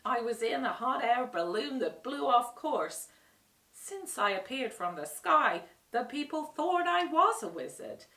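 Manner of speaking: lightly voiced chatty old man. A narrator's reading. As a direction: ramble through his confession. Oz confessing his story.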